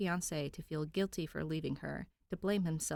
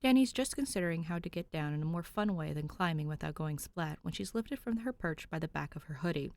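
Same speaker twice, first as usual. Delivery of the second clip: usual speaking voice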